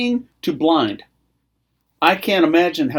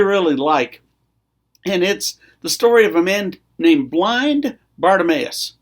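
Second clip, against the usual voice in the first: ordinary delivery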